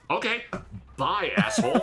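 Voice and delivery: a fake-polite bye with a laugh in it; mock cheery goodbye. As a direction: chirp it sweetly before the insult. Mocking send-off to the customer.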